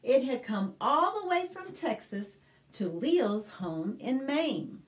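ordinary talk

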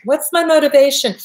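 a hint of an earnest actor's question. Coach mimics an actor asking about motivation, lightly acted. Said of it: "It sounds like an actor".

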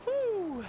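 high, loud "whew"; relieved whoop. Real, not acted. Player after a close call in the game, relieved whoop. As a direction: let out a sharp whoop of relief.

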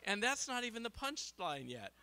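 barely holding it together, shouting through laughs; laughing excitement. Real, not acted. Speaker cracking up at his own joke, loud laughing shout.

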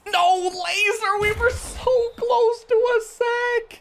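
high, drawn-out wail of dismay; anguished disbelief. Real, not acted. Gamer watching his pass rush miss the sack, anguished wail. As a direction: groan it out slowly, so close yet missed. Opponent escapes a near sack.

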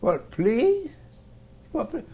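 quoting him back softly; mimicking. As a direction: repeat his words, mocking a little. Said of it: "that's how you should have answered".

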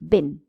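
Bin with a short ɪ. Shown as a demonstration. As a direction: clip the i in Bin. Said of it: "Let's see the difference".

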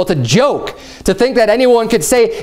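ordinary talk, nothing marked

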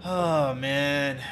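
normal talking voice

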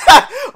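a loud, high burst of laughter; big high laugh. Real, not acted. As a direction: crack up loudly after the song. Laughs after stopping his song.

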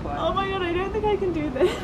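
high, soft and shaky from cold; breathless in freezing water; in ice cold water. Real, not acted. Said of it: "It's actually so cold".

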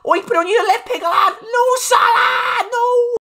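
high, frantic shout; urgent high-pitched screaming; excited shouting. Real, not acted. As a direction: yell at the player as the chance unfolds. Goal chance in live play.

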